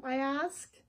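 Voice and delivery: ordinary delivery, nothing marked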